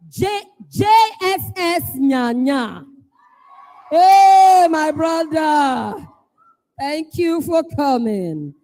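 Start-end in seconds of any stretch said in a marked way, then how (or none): 3.9-6.1 loud greeting. Real, not acted. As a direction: hail a friend across the hall. MC greets arriving school loudly.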